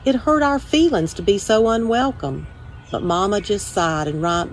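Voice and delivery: usual speaking voice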